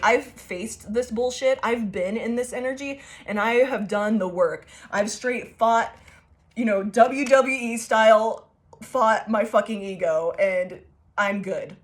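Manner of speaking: usual speaking voice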